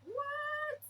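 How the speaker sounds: squeaky high confused "What?"; a kid blurting out in disbelief; surprised child. Retold or imitated. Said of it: "and they'll be like".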